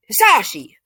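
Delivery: loud, high scandalized yell; scolding shout. Retold or imitated. Wife Inko scolds husband Hisashi, loud outraged shout. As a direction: snap the husband's name in outrage. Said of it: "Inko just like".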